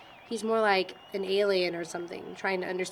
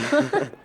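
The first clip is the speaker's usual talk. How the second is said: laughing; 6 semitones higher, 7 dB louder than usual. Acted. Laughs at own joke.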